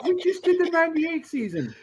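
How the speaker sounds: high-pitched protest through laughter; real laughter lifting the voice high; laughing protest. Real, not acted. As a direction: protest while cracking up. Laughing protest at friend's jibe.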